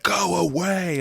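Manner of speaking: high, held-back mock yell; drawn-out, exasperated pretend shout; mock scream. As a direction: imitate screaming without the volume. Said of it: "he screams at them".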